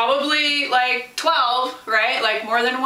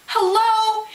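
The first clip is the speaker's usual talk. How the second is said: high, emphatic hello; you can't be serious; preacher mocking the bad rap; incredulous hello; 10 semitones higher than usual. Real, not acted. Mock disbelief at critics.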